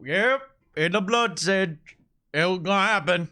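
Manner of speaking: high, loud rough character voice; Jekyll voice. Acted. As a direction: growl it out as the ship's mate. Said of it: "you just hear Jekyll".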